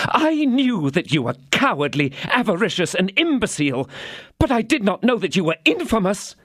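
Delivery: high, scornful denunciation; wife scorning her cowardly husband; angry wife. A narrator's reading. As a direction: build the insults to infamous. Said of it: "seen his wife angry".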